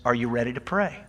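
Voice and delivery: ordinary delivery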